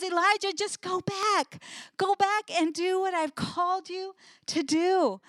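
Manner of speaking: mild voicing of God commanding Elijah; God's urging. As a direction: urge him back, encouraging and firm.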